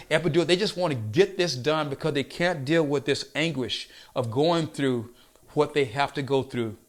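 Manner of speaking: ordinary talk, nothing marked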